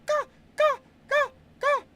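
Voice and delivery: sharp high bird caws; loud high ka calls like a crow. Shown as a demonstration. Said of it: "I'm gonna do it in the ka".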